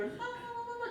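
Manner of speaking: high, soft singsong rabbit la-las; oblivious wee rabbits. Acted. Wee rabbits before being cooked, high oblivious singsong. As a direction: squeak it softly like carefree little bunnies.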